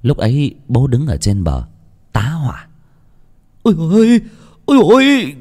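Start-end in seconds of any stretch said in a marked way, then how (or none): 3.6-5.3 high, loud frightened cry; father terrified at the drowned body; panicked cry. Acted. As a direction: yelp in sudden panic.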